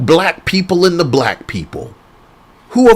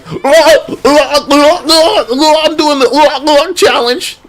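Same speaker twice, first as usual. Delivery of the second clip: high, loud mocking oh-oh chant; mock squealing crowd; 11 semitones higher, 5 dB louder than usual. Mocking everyone doing a silly trend, high loud squeals. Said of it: "everybody was like".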